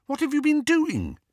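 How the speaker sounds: high-pitched, incredulous demand; aghast question. A narrator's reading. Hermione seeing the ransacked room, aghast. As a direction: ask it appalled, voice shooting up.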